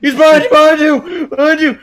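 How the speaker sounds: high loud warning yell; panto crowd callback. Real, not acted. Warning teammate of enemy.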